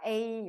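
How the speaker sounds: A drawn out slowly as 'ei'; correct reading. Shown as a demonstration. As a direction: say A as a long gliding 'ei'. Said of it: "라고 읽습니다".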